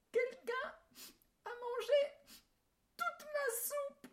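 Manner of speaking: very high, wailing tiny bear voice; a tearful baby bear, whimpering; crying little bear. A narrator's reading. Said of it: "se mit à pleurer". (petit ours qui pleurniche d'une voix aiguë)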